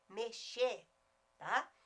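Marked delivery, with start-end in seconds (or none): none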